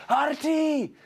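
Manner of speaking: high, urgent cry of the name; alarmed warning call. Acted. Warning cry as dragon rises.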